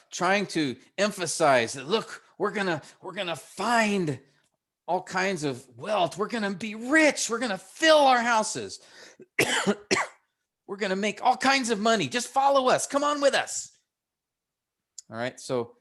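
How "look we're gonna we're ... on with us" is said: higher, eager voice of smooth-talking tempters; enticing sinners. Acted. Pastor voicing sinners luring the young man, coaxing higher voice. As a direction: lure them in, coaxing and eager. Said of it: "these sinners who are enticing".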